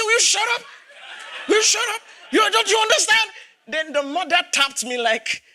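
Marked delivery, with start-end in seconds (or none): 0.0-3.3 angry shout. Retold or imitated. Pastor retelling how he yelled at his kids: angry shout. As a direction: snap at the kids in a burst of anger. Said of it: "I was so upset".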